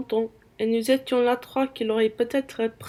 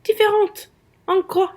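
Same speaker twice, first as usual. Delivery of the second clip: high, louder scornful question; indignant echo; 8 semitones higher, 3 dB louder than usual. Acted. Wife retorting to the defender of the girl, indignant. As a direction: throw the word back at him.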